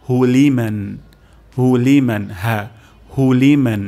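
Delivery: হুলিমান said loudly, stressed; emphatic repetition. Shown as a demonstration. Teacher loudly drilling হুলিমান for the students. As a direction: ring out হুলিমান clearly. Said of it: "তারপর শব্দটি হলো".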